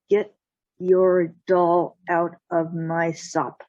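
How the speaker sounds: slow, deliberate warning. Acted. Jack warns the cat off his gravy, slow and firm. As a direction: space out each word as a firm threat.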